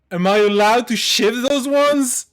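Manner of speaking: loud, high bitchy retort; mock snippy. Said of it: "In kind of a bitchy tone".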